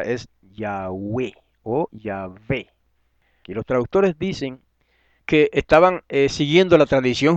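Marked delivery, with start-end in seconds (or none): 0.4-2.7 slow, measured "Yahweh o Yahvé", each stressed on the final E; correct pronunciation. Shown as a demonstration. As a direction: set out "Yahweh o Yahvé" slowly as ya-WEH, ya-VEH. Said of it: "la pronunciación es correcta".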